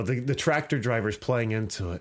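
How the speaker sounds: ordinary talk, nothing marked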